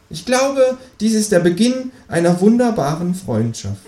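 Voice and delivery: high squeaky kangaroo voice. Acted. Cheeky kangaroo asking the author, high voice. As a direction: pitch it way up as the Kangaroo.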